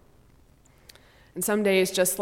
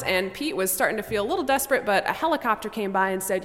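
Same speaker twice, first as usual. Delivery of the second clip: ordinary talk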